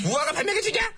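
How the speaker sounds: high, exasperated yell; angry retort. Acted. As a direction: throw the advice back angrily. Ruler mocks the advice.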